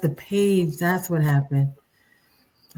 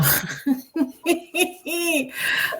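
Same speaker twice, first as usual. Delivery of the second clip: short high laugh at herself; a bright amused laugh; light laugh; 10 semitones higher than usual. Real, not acted. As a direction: laugh at your own mess. Laughs at own messiness.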